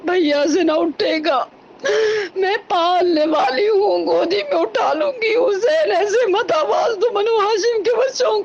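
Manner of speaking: sobbing, rising lament of the martyrdom; tearful lament. Acted. Preacher mourning Ali Akbar's death before the congregation, weeping. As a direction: wail the story with breaking voice. Majlis masaib recitation.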